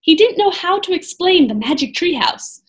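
plain reading voice

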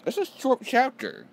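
very high, loud protest; dismayed outburst. Real, not acted. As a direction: wail at being cut short. Dismayed that the chapter ends.

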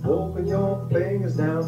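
usual speaking voice

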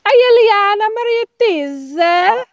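high, drawn-out Welsh lilt; slow, swooping Welsh singsong; singsong Welsh accent. Retold or imitated. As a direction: imitate a Welsh woman almost singing her words. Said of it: "sounds like she's singing or something".

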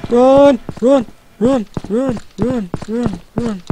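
high panicked chant of run; frantic, terrified, urging yourself on; scared horror game streamer. Real, not acted. Fleeing monster in horror game.

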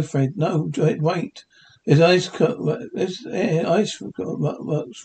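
ordinary talk, nothing marked

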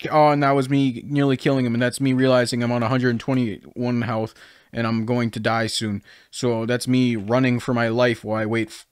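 usual speaking voice

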